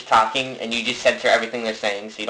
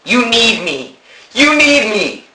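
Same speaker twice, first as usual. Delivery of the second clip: high, loud singsong taunt; 10 semitones higher, 6 dB louder, slower than usual. Acted. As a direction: taunt him childishly. Mock quarrel.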